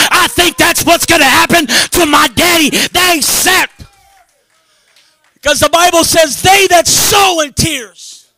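very loud, high-pitched testifying; full-force preaching. Real, not acted. Preacher declaring his family will someday be saved, full-force preaching. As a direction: cry out faith in driving, shouted phrases.

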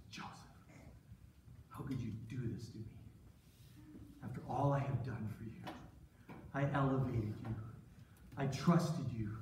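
low, subdued reproach; hurt. Acted. Potiphar confronts Joseph, quietly hurt. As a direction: hold it down, wounded by betrayal. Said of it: "I could tell it really hurt him".

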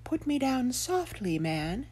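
high, soft little branch voice; talking branch. Acted. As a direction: plead softly as a small branch. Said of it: "But the branch said".

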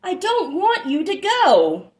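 a small calf begging mama to stay; little one pleading. A narrator's reading. Child calls to mother, high and pleading. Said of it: "said little one".

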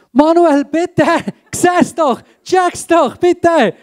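urgent plea. Shown as a demonstration. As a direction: beg him to finally see it. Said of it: "Oder noch eine Steigerung".

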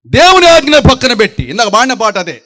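forceful sermon burst; preaching loudly. Acted. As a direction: declare it loud from the pulpit.

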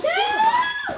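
high squealing oh superbug; excited shriek. Real, not acted. Mom remembering the Superbug test, an excited high shriek. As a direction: burst out with sudden delight.

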